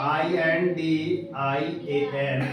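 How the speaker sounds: each letter of Indian said slowly and apart; slow spelling. Shown as a demonstration. Teacher slowly spelling for the class. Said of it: "जिसको बोलते हैं आप Indian".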